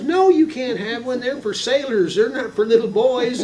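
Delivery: high, loud Texan mother refusing; scolding mom. Retold or imitated. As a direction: scold like a mom laying down the law. Mother refusing child in story.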